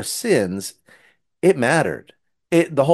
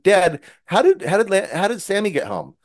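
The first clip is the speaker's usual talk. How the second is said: put-on higher boy's question; puzzled little boy; 8 semitones higher than usual. Rufus asking dad about the lamb, higher and puzzled. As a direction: ask dad like a confused child. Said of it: "and he said".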